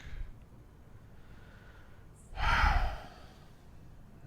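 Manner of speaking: long breath out in a loud sigh; heavy sigh. Acted. Narrator recalling your drunken denial, heavy sigh. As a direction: let out a weighty sigh. Narrator pauses before evening turns sour.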